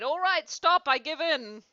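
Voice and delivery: high, loud plea of surrender; acted wail of defeat; whimpering cry. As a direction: cry out as if beaten. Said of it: "he whimpered and moaned and cried".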